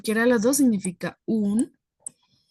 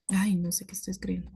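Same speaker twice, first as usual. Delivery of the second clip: self-muttered whisper; 7 dB quieter, faster than usual. Real, not acted. Teacher mutters at own writing.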